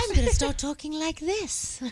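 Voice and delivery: light Disney princess voice; a hint of a dainty princess; cartoon princess. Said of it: "sounding just like a Disney princess".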